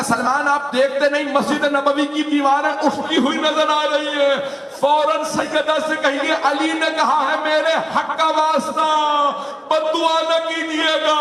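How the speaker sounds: urgent high cry. Acted. Ali urging Salman to stop the curse, high anguished cry. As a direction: cry out the warning with rising urgency. Ali's urgent plea, climax.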